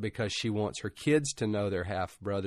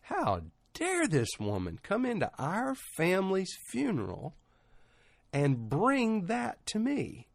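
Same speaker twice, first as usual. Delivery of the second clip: mild outrage, higher and drawn out; retold indignation; 8 semitones higher, slower than usual. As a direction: voice her boiling indignation without shouting. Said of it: "my blood began to boil".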